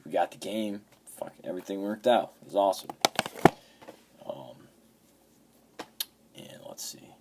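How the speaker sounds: usual speaking voice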